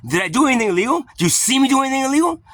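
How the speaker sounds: high, rising challenge; indignant questioning. Retold or imitated. Challenging the officer over a bike light stop, indignant. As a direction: demand an answer with mounting indignation.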